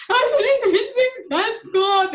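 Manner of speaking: loud, high-pitched wail; hammed-up sobbing cry, drawn out and shouted; bawling. Coach re-enacting breakdown to her husband, bawling. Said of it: "bawled my freaking eyes out".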